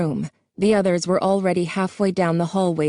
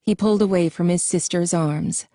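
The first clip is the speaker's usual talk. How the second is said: ordinary delivery, nothing marked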